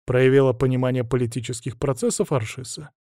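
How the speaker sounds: plain reading voice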